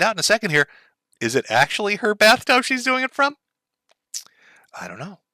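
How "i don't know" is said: hushed, under the breath; whispered aside. Real, not acted. To chat, a teasing whispered aside. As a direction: drop to a whisper, sharing a secret.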